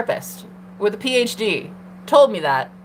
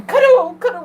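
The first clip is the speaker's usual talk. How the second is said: squeal; 10 semitones higher, 6 dB louder than usual. Real, not acted. Inviting viewers to cuddle.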